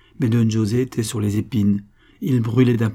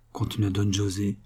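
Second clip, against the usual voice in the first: ordinary delivery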